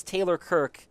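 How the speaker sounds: ordinary talk, nothing marked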